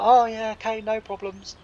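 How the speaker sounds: high-pitched mock-obliging phone reply; imitated breezy agreement. Imitating Anthony placating John on the phone, high-pitched and agreeable. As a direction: play him all easygoing, pitched well up.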